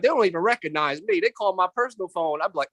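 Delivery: ordinary delivery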